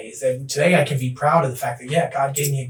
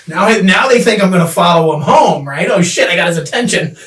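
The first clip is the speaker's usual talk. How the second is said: laughing overcorrection; 5 semitones higher, 11 dB louder than usual. Retold or imitated.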